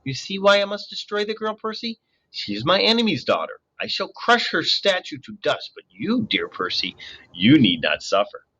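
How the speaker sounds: high, soothing purr. A narrator's reading. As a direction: lull him with a sweet voice. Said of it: "comfortable purr".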